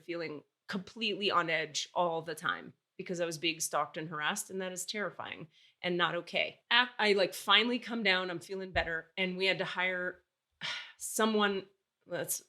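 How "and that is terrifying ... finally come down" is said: ordinary talk, nothing marked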